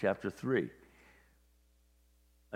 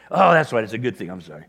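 sudden realization; 11 dB louder than usual. Retold or imitated. Parent corrected gently, realizing loudly they simply went to college. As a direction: jolt of realization that fades into an apology.

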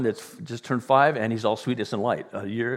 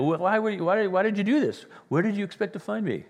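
higher, anxious parental scolding; upset parents; 8 semitones higher than usual. Retold or imitated. Jesus' parents scolding him.

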